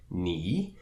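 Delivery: "ni" rising like a question; second-tone "ni", climbing upward. Shown as a demonstration. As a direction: lift the pitch through "ni". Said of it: "we could have the second tone".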